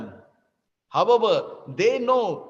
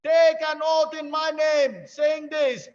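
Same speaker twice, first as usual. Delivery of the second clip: loud, higher rallying call from Krishna; commanding shout; 4 semitones higher, 3 dB louder than usual. Acted. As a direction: rouse Arjuna like a battle cry. Krishna commands Arjuna to proclaim.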